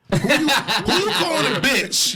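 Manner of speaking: high, slow shouted lyric with laughter; kid belting the song. Retold or imitated. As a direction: belt the line at her. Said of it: "How the song go".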